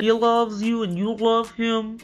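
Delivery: slow, imitated drunk voice; drunken slurring. Florian butting in on the sisters, drunken slurring. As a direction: slur it like a staggering drunk. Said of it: "Florian drunkenly staggered over and said".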